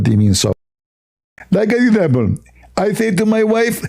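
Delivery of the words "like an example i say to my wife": high, squeezed, spitting lisp; mock preacher voice, lisping and pitched up; Zakir Naik. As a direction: squeeze the words out with a spray of spit. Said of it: "I speak this way only when".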